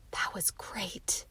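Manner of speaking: slow, whispered awe; boy awed after the story, whispering; breathless whisper. A narrator's reading. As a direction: let it out on a long breath. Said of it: "drawing a long breath".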